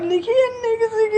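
fighting back tears as she speaks; tearful. Real, not acted. A stranded woman pleading, on the edge of tears. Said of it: "ਉਹ ਰੋਂਦੀ ਕਾਹਨੂੰ ਹੈ ਤੂੰ?".

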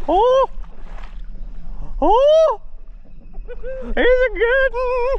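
high excited yells at a hooked fish; excited shouting. Real, not acted. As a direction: yell with delight as the fish fights.

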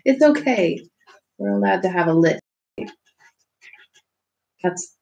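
ordinary delivery

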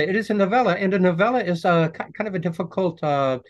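high, loud and fast, insisting on it; high excited protest. Real, not acted. Author stressing to interviewer it's a novella, high and fast. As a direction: squeal it out with mock exasperation.

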